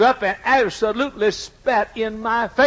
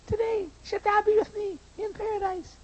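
high, softer taunting parody; mocking; 9 semitones higher, 10 dB quieter than usual. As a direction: sneer the promise back at him. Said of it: "I absolutely mocked him".

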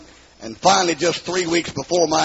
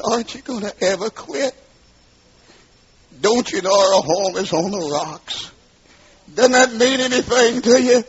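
imitated distraught wife, voice climbing high; tearful pleading; 6 semitones higher than usual. Wife begging her drinking husband to quit, tearful pleading. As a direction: plead higher and higher, as a wife in tears. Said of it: "her tears running down her face".

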